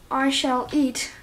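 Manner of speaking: ordinary talk, nothing marked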